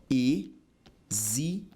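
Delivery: exige broken into e, xi, ge with long pauses; syllable by syllable. Shown as a demonstration. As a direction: sound out exige slowly, one syllable at a time. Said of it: "olha só".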